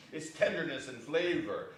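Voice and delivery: usual speaking voice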